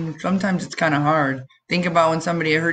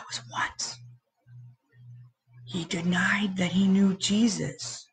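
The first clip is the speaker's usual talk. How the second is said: whisper; 2 semitones higher, 6 dB quieter than usual. A narrator's reading. Solemn reveal to children.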